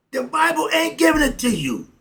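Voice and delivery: high and loud, hammering the point; loud declaration. Real, not acted. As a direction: proclaim it hard to the audience. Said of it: "Oh, excuse me".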